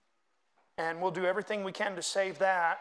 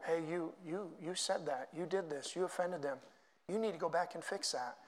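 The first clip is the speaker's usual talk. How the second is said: a hushed, much softer voice of conscience pointing a finger; inner voice of conscience; 9 dB quieter than usual. Retold or imitated. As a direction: murmur it like a nagging conscience. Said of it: "you know who helps us to remember that".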